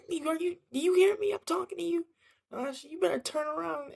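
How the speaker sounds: very high-pitched imitation of a frightened mom; scared yelling. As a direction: mimic a panicked mother scolding in a squeaky high voice. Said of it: "she starts yelling all scared".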